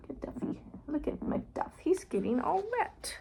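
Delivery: soft whispered aside; hushed storytime whisper. Acted. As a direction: whisper it to the child.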